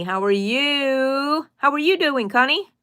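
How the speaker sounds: sing-song greeting. Real, not acted. Greeting a viewer on live.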